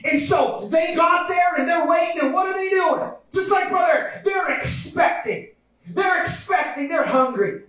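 high, emphatic sermon delivery; fervent preaching. Real, not acted. Preacher describing the men waiting, high fervent preaching.

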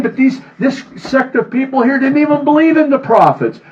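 normal talking voice

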